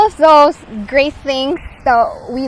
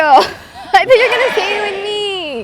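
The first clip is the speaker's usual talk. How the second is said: words said through a laugh, a bit higher and slow; 9 semitones higher, 3 dB louder than usual. Real, not acted. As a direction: laugh while teasing the partner. Laughing after the failed joint reveal.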